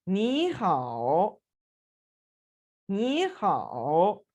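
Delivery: very slow नी हाओ with नी rising and हाओ dipping; tone sandhi ni hao. Shown as a demonstration. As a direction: draw out नी हाओ, first syllable rising. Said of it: "दोबारा से बोलता हूँ".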